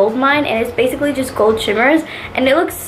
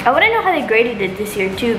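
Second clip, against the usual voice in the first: ordinary delivery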